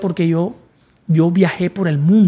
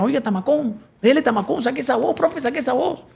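high, playful coaching voice; teasing mentor; 7 semitones higher, 4 dB quieter than usual. Retold or imitated. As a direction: egg him on like a joking teacher. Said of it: "me decía el mamá gallo". (maestro bromista, voz aguda animando a cantar)

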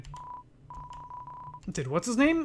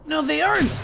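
higher defiant cry; defiant boy Lancer; 6 semitones higher than usual. Acted. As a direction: stand up to his father.

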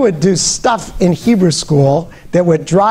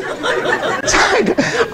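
high squealing laugh; 3 dB quieter than usual. Real, not acted. Audience-style laugh at himself.

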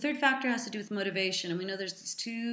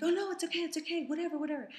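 very high-pitched imitation of keen kids; eager dismissal; 10 semitones higher, much faster than usual. Kids who just want the book, imitated eager dismissal.

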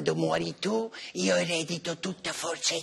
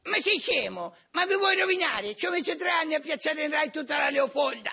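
high-pitched indignant squeal; high squeaky outrage; 8 semitones higher than usual. Acted. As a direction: squeal it out, scandalized. Echoes same outrage.

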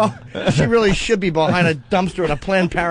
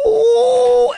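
high shrill-free squeal, much louder; mock the bride's delighted squeal; excited squeal; 5 dB louder than usual. Retelling the tiny bride's proposal reaction, mock squeal. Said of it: "and she went".

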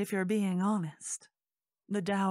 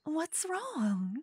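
sweet scheming hostess; 7 semitones higher than usual. Acted. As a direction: coax the guest to stay, sugar over suspicion. Host character speaks to guest.

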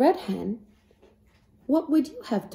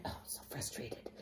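frustrated whisper; 9 semitones lower, 23 dB quieter than usual. A narrator's reading. Hen frustrated, whispered aside.

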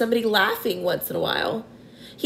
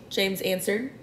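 plain reading voice

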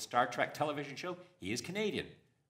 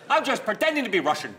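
high, loud shouted Sean Connery; Sean Connery impression; 13 semitones higher, 14 dB louder than usual. Comic mocking Connery's fake Spanish role, loud and high. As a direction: bellow it as a goofy Connery. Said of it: "It's me, Sean Connery".

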